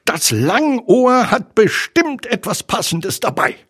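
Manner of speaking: high, confident tiger voice; Tigger. A narrator's reading. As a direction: say it eagerly while rummaging. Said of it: "meinte Tigger".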